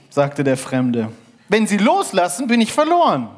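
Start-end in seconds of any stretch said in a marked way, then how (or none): 1.5-3.3 hoch und laut von unten gerufen; Fremder am Seil. Acted. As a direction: ruf eindringlich nach oben. Fremder ruft vom Seil. (eindringlich gerufen, hoch)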